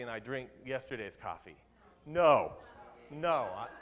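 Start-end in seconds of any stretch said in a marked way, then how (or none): 2.0-3.5 mock correction. Correcting the stale-coffee joke.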